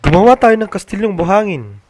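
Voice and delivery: put-on high, loud child's excited proposal; eager suggestion. Ben proposing a sandcastle to siblings, high and eager. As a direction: pitch the idea bursting with excitement.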